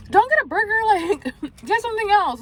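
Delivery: high-pitched bossy friend warning; friend's voice. Retold or imitated. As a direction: squeak it out like a pushy friend.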